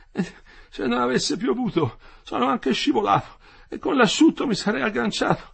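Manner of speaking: very high, strained, distressed voice; agitated. A narrator's reading. Injured worker going over his fall, agitated. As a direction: fret it out, straining upwards. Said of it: "si agitava".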